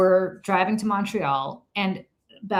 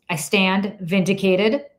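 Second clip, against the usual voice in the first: ordinary talk, nothing marked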